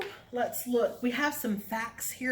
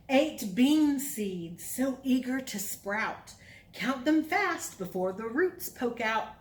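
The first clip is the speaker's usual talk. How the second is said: put-on reading to children; 3 semitones higher, slower than usual. Reads counting rhyme.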